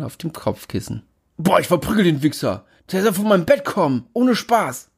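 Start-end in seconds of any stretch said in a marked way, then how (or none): 1.4-4.9 angry roommate. A narrator's reading. Niklas furious about his bed.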